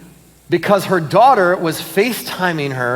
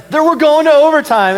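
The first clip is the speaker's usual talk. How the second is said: excited cheer; 10 semitones higher, 5 dB louder than usual. Retold or imitated. Retold cheer as game tied.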